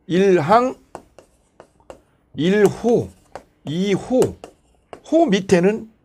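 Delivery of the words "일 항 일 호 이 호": each item set apart by long pauses; formal statute reading. Shown as a demonstration. Teacher shows students how clauses are read, measured citation. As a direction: cite each clause number separately and deliberately. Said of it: "이렇게 읽어주는 게 정통이에요".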